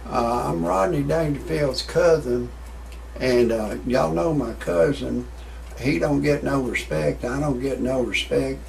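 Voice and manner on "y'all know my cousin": usual speaking voice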